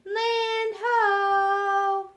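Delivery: long, high, loud sung-out call from aloft; lookout's cry. A narrator's reading. Lookout calling down to the ship, long loud cry. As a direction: sing it out from the masthead. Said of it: "heard the cry".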